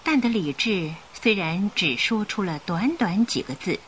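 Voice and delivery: usual speaking voice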